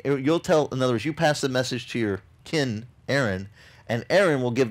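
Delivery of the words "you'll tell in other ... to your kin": normal talking voice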